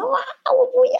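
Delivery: high mock wail of a child; child screaming. Mother imitates her son wailing, high mock wail. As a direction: pitch way up and wail like a crying kid. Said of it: "然后尖叫".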